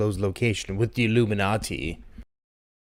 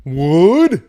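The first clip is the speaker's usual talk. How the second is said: loud yelled joke line, higher; shouted bit; 5 semitones higher, 10 dB louder, slower than usual. Acted. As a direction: belt it out like a punchline. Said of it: "Sorry, I had to".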